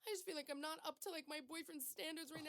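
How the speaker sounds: high, wavering retold crying; mock tearful. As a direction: imitate her own teary rant, half-mocking. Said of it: "I was crying".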